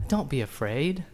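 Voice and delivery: Jesus comforting the fallen John, tender and soft; gentle reassurance. Acted. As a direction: say it like a hand on the shoulder.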